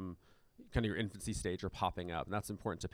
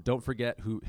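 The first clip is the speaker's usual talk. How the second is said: hint of a Southern twang, louder; 6 dB louder than usual. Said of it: "it's like a super Southern twang".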